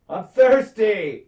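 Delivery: loud, high, drawn-out holler; mock announcing shout. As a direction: bellow it like a careless lord walking off. Acted lord announcing as he leaves.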